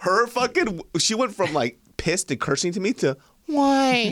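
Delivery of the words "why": squeaky high innocent why. Retold or imitated. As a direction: play wide-eyed innocent. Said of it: "Fucking anime eyes".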